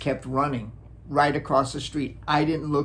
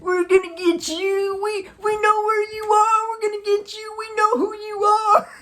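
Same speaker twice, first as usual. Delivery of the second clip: mock teen threat. Teenagers threatening from the yard.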